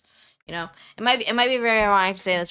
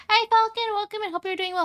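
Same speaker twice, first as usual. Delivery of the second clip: high, sing-song welcome; pitched-up welcome to a viewer; bright greeting; 13 semitones higher, 4 dB louder than usual. Real, not acted. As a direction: call out a cheery hello to Falcon.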